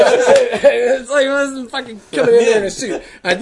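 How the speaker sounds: laughing. Real, not acted. Laughing while telling bar story.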